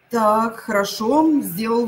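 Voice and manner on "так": normal talking voice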